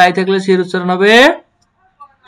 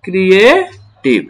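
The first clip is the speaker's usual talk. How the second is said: "Creative" slowly split, rising then falling; "creative" in two slow pieces; syllable demonstration; much slower than usual. Shown as a demonstration. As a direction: say "ক্রিয়ে", pause, then drop on "টিভ". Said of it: "তাহলে এটা দাঁড়াচ্ছে কি?".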